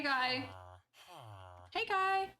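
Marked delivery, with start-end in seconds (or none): 1.7-2.3 high coaxing lure, pitched way up; luring voice. Acted. Luring a villager toward the trolley, high coaxing call. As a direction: sweet-talk the creature in like bait. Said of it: "My luring voice is truly horrible".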